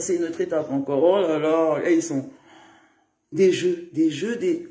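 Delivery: ordinary talk, nothing marked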